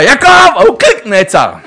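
a huge, high shout of the son's name; furious shout. Retold or imitated. Father yelling at his running son, loud high shout. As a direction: yell the name to freeze him on the spot. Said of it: "אז כזה צעקה".

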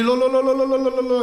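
frantic repeated no. Retold or imitated. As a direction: panicky high-pitched refusals. Guitarist refuses in panic. (סירוב בהול וגבוה)

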